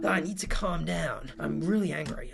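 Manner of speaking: a hint of an irritated woman reading her message; mock offended woman, understated; annoyed chat voice. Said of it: "I'm not gonna do the voice the whole time".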